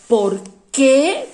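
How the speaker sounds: "¿Por qué?" loud, rising on "qué"; loud classroom call of "¿Por qué?"; stressed question word. Shown as a demonstration. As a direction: push "qué" in "¿Por qué?" hard and up. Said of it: "ahí lleva su acento".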